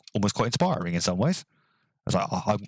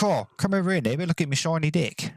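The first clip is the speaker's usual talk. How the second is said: higher, hammed-up New Zealand accent, deck said like dick; mock New Zealand accent; 8 semitones higher than usual. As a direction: boast about the deck in an exaggerated Kiwi accent. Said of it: "the way New Zealanders say deck basically sounds like dick".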